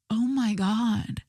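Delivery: slow, drawn-out mock dismay; appalled reaction. Podcaster recalling girls walking to brunch, appalled. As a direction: stretch every word in disbelief.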